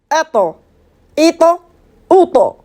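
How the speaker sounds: "attends, ito, uto" as at', it', ut' with a heavy T that rebounds; bouncing emphatic T. Shown as a demonstration. Teacher shows vowelless letter ta, bouncing heavy "attends, ito, uto". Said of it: "comment je rebondis la lettre".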